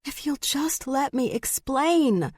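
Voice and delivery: high, drawn-out pleading; desperate plea. A narrator's reading. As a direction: plead for a chance to be heard. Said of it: "desperate for her to listen".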